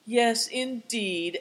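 slow, sighing agreement; a rueful old man's slow sigh. A narrator's reading. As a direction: let it out on a weary sigh. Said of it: "answered Affliction with a sigh".

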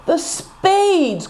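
high, despairing groan. A narrator's reading. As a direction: groan it in dismay. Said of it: "groaned Good Fortune".

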